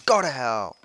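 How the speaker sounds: shouted at the mob; angry loud shout; loud yell. Real, not acted. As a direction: bellow it at the monster. Yelling at mob.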